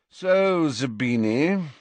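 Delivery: high, drawn-out opening; loud, lingering lead-in; drawling address. A narrator's reading. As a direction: draw the name out, high and leisurely. Malfoy opens talk, haughty drawl.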